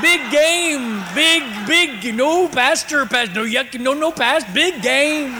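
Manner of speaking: high, loud, jumbled and laughing; flustered babble. Pretend. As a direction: tumble over the words in mock panic. Comic flustered babble about game.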